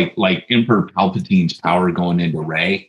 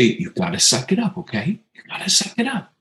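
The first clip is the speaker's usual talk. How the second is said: gently coaxing, rising at the end; encouraging, not mean; 7 semitones higher, 4 dB quieter than usual. Retold or imitated. Dad encouraging his daughter to push through, gentle and upbeat. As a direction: cheer her on softly through the leg braces. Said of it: "I don't say it mean".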